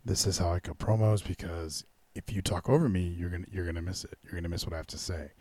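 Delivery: low hushed menace, quickening at the end; mock Chris Jericho whisper-quiet threat; imitating Jericho's hushed promo. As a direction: pull the volume down and make them listen. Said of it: "talking like this now".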